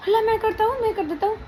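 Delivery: imitating husband. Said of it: "तुरंत भागाएगा".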